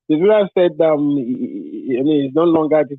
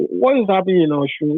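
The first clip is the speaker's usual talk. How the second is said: normal talking voice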